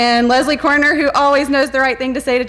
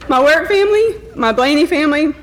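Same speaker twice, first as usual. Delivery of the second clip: higher voice, choking back tears; fighting back tears; 5 semitones higher than usual. Real, not acted. Principal thanking her work family, high-pitched and trying not to cry. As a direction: push through the urge to cry. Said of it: "I'm not gonna cry".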